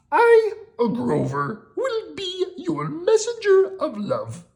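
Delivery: very high, slow, proud monster declaring; Grover voice. Acted. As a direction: Grover swearing to carry the letter.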